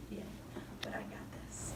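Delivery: slow, hushed whisper; whispered reassurance. Retold or imitated. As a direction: breathe it softly like a secret. Jesus voiced calmly to Peter.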